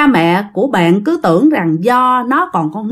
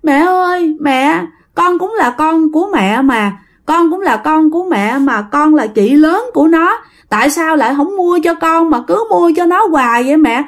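put-on pleading spirit child; 7 semitones higher than usual. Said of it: "cái nó nói".

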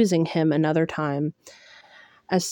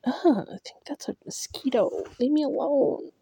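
high, hushed annoyed whisper; irritated whisper, pitched up; whispered grumble; 8 semitones higher, 5 dB quieter than usual. Acted. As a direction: swat the pest in a higher whisper. Character swatting, muttered aside.

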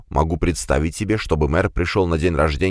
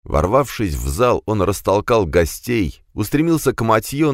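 usual speaking voice

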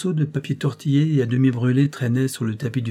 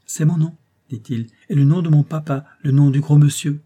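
put-on higher, bright child chatter; a child piping up, higher and louder; little boy voice; 5 semitones higher, 7 dB louder than usual. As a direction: play an eager small boy proudly naming himself. Child George speaks.

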